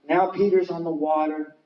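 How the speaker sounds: normal talking voice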